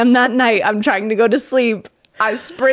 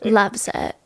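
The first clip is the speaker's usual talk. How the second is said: lightly put-on socialite voice; a hint of celebrity impression on the catchphrase; 4 dB quieter than usual. Host asking if she nailed her idol, light impression. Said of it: "Did I get it?".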